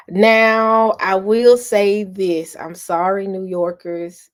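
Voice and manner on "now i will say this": louder, slow, weighty declaration; slow down and land each word; emphatic announcement. Real, not acted. Announcing a confession.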